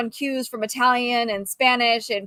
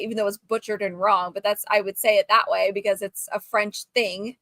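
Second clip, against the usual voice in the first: normal talking voice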